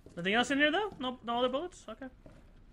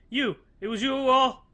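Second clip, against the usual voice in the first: hammed-up accusation; 5 semitones higher, 5 dB louder than usual. Hammed accusation reading note.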